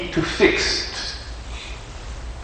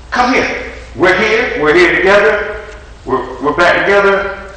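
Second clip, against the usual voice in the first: excited caller; 7 semitones higher than usual. Retold or imitated. Caller pleading to come over.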